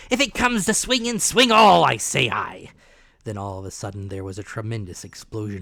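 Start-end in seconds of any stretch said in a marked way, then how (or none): 0.1-2.7 loud, high pirate yell; shouting captain. A narrator's reading. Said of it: "he cried once and again".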